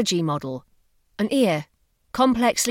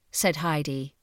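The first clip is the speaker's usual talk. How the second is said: ordinary delivery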